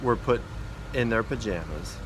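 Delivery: ordinary delivery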